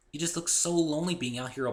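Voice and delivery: a hint of a tender young pony. A narrator's reading. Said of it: "she said tenderly".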